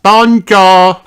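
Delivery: put-on "Bonjour" said as a child's French hello; high, loud "Bonjour"; childlike bonjour; French greeting. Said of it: "bonjour means hello".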